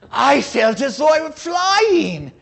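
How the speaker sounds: thrilled cry reliving the ride on the cowcatcher; exhilarated. Retold or imitated. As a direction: shout out the joy of flying.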